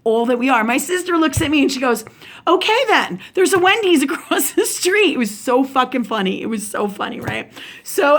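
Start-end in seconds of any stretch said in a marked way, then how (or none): 2.5-5.1 high-pitched retold suggestion breaking into laughter; laughing mid-line, voice lifted; amused quote. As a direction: imitate a sister's comeback, laughing through it. Retold sister's funny remark.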